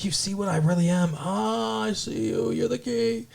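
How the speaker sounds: eerie patient. Retold or imitated. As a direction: creep her out in a hushed high voice. Mad patient confronts Dawn.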